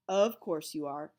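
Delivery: low soothing teacher; calm him gently; teacher comforting an upset boy, low and soothing. A narrator's reading. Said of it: "soothed Mister Jupiter".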